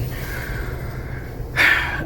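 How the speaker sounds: long weary sigh; a tired sigh before the hard part; heavy sigh. Real, not acted. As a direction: let out a heavy breath. Sighs before sad memory.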